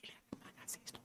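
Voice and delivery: hushed whisper of an inner voice; a faint guiding whisper. Pretend. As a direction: breathe it like a quiet nudge from within. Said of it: "tenemos una vocecita".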